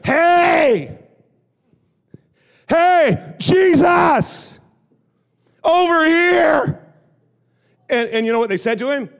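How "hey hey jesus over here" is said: shouting high and loud across a crowd; crying out. Acted. Blind man shouting to Jesus over the crowd, desperate yell. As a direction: blind beggar yelling for Jesus. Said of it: "he just started crying out".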